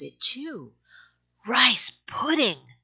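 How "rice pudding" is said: high, slow, eager puppy voice; yappy panting puppy. Acted. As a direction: savour it like an eager pup. Puppies smell rice pudding.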